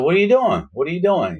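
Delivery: high-pitched retold disbelief; alarmed questioning. Inmate to the friend locking him in, alarmed. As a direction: act out a startled protest, pitch jumping up.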